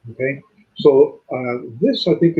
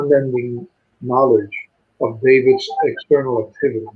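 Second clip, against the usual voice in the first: ordinary delivery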